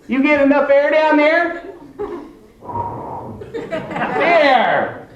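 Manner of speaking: high, sing-song talking down; patronizing high voice. Pretend. Mocking a man with an oxygen tank, high and patronizing. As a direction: speak like to a toddler.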